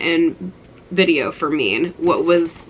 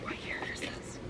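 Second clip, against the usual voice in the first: hushed self-question, barely voiced; whispered aside; 21 dB quieter than usual. Real, not acted. Whispering to herself while checking a magazine date.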